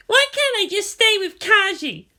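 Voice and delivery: put-on a girl demanding her way; sulky teen girl. A narrator's reading.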